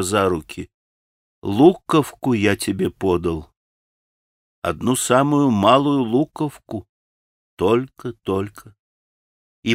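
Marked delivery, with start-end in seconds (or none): 1.4-8.8 tender, moved. Acted. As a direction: say it tenderly with a smile. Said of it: "умилённо улыбаясь". (умилённо, с улыбкой)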